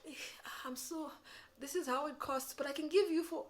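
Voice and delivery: high, wavering, unsure seller; hesitant discounting seller. Retold or imitated. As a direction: fumble apologetically over the price. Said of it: "Don't be sitting and saying".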